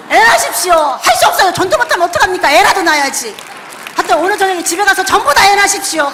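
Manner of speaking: loud, high preaching exhortation; fervent preaching. Acted. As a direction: exhort the congregation at full force. Preaching at full force. (힘차게 외치는 설교조)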